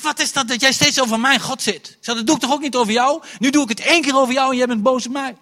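high, loud, fast indignant rant; indignant outburst. Retold or imitated. Preacher telling off the classmates in class, loud and indignant. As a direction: let the hurt anger pour out fast.

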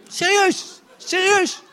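high, loud disbelief; can't believe it really happened; incredulous. Real, not acted. Preacher telling the congregation he means it, high and incredulous.

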